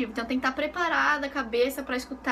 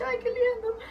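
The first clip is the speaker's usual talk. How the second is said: cute squeal; 9 semitones higher than usual. Acted. Cute reaction to her toy.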